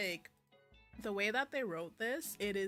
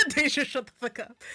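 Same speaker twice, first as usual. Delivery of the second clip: loud, high, laughing protest; laughing outburst; 7 semitones higher, 16 dB louder than usual. Real, not acted. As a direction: burst out laughing as you protest. Reader laughing at character's line.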